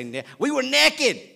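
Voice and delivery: "naked" as NEK-id, loud and high; the local folks saying "naked" as NEK-id; Beaufort County naked. Retold or imitated. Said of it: "But we say".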